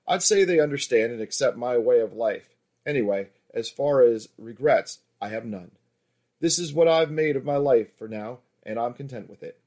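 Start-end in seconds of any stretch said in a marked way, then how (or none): none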